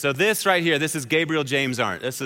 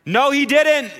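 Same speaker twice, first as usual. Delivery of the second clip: put-on high, loud child's call; tattling older brother; 8 semitones higher, 5 dB louder, slower than usual. Older brother telling on his little brother, loud high call. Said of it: "the voice of his dutiful older brother".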